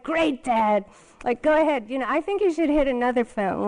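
mock Dad for hitting the fountain; angry sarcasm. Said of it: "I was just like".

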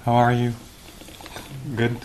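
ordinary talk

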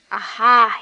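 put-on a loud, roaring drunk's cry; roared. A narrator's reading. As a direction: bellow it out like an angry drunk. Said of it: "he roared".

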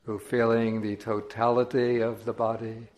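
measured meditation voice with long silences between phrases; solemn, unhurried contemplative delivery; guided meditation. Acted. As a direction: guide listeners slowly, drawing out key words.

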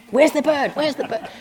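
high, frantic bird watcher voice; eager twitcher. Pretend. Mocking obsessed twitchers searching for a bird, frantic and high. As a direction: hunt for the bird in a rush. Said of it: "They're like".

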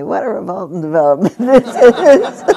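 gülerek, neşeyle söyler; laughing through the phrase. Shown as a demonstration.

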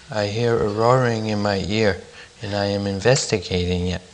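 a touch of timid shyness. A narrator's reading. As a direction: answer softly, a little shyly. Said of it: "he answered timidly".

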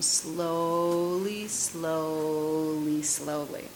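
slow-motion delivery, easing down; drawn-out slowly. Shown as a demonstration. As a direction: stretch each word out to guide slow movement. Acting out slow-motion arm movement.

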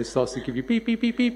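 high mock beeping like a seatbelt alarm; car warning beep impression; imitated car beeps.

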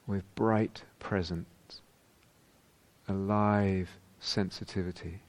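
unhurried meditation voice with long pauses; guided meditation. Real, not acted. Teacher guiding meditators into whole-body awareness, calm and spacious. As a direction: guide them calmly, leaving space after each phrase.

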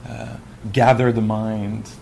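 ordinary talk, nothing marked

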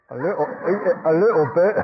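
ordinary talk

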